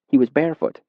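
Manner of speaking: usual speaking voice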